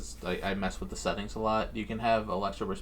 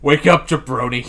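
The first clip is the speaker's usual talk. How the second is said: loud, higher mock wake-up shout; The Rock; 4 semitones higher, 11 dB louder than usual. Wrestler's alarm app waking you, mock shout. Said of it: "telling you to wake up".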